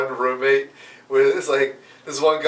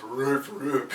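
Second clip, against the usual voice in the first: dog bark; 2 semitones lower, 8 dB quieter than usual. Retold or imitated. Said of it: "out of his mouth goes".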